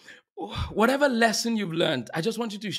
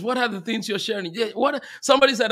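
ordinary talk

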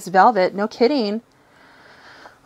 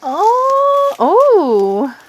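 loud, high "ooh" of delight; delighted squeal; 10 semitones higher, 6 dB louder than usual. Real, not acted. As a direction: squeal loudly, high then swooping down. Squeals at the next find.

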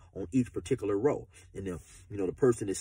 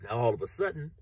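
usual speaking voice

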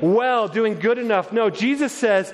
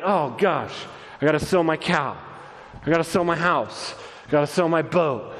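lower, downhearted grumble of obligation; mock reluctance; 5 semitones lower than usual. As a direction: act out giving things up with a heavy heart. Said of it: "it isn't like".

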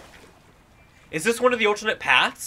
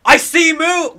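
loud, high startled call; excited alarm; 7 semitones higher, 9 dB louder, slower than usual. Real, not acted. Player spotting Moon on the ride, excited shout. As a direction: shout it out on the sighting.

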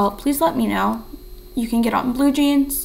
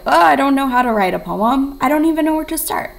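louder, higher whine of not knowing how; mock helpless student; 3 semitones higher, 5 dB louder, faster than usual. Teacher voices a student stuck on writing a poem, mock helpless. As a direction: imitate an overwhelmed student, voice pushed up and louder. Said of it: "if you're like".

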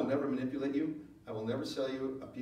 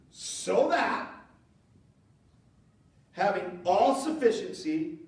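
loud, higher, drawn-out preaching; full-force preaching; 6 semitones higher, 7 dB louder, much slower than usual. Acted. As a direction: build it up to the church, slow and loud.

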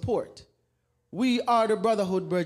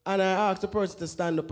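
ordinary delivery

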